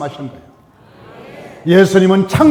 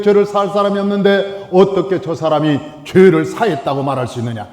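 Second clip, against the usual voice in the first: ordinary delivery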